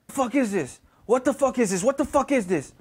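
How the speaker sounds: imitated demanding mother, rising in pitch; retold angry confrontation. Mom finds drugs in son's shoe, retold angry demand. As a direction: act out an outraged demand for answers.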